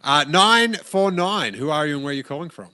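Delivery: upbeat call-in show host; radio host voice. Acted. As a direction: introduce the caller like a DJ. Radio host takes a caller.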